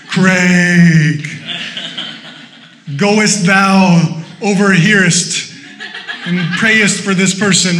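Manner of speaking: booming, slow mock-divine voice; God. Preacher parodying God calling Craig from the heavens, booming and solemn. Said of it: "He doesn't always speak like that".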